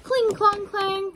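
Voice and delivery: high, ringing battle sound effects; clashing swords. Acted. Reader imitating the battle for a child, high sword clangs.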